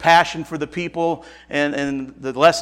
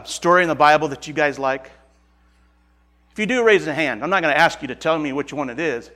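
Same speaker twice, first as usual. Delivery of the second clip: ordinary talk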